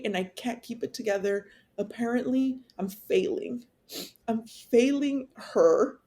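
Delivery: holding back tears while getting it out; real emotion welling up as she talks; tearful. Real, not acted. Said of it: "that even makes me sad".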